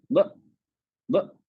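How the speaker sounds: ब very short, shut by a glottal stop; cut-off vowel. Shown as a demonstration. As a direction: snap the throat closed right after each ब. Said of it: "कट जाएगी आवाज". (ब बोलते ही आवाज कट जाए)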